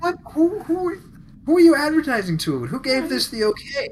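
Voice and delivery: high, loud disbelieving cry; incredulous. Retold or imitated. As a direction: shriek in disbelief. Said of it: "And I'm like".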